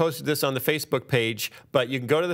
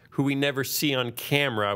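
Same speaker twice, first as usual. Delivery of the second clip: ordinary talk, nothing marked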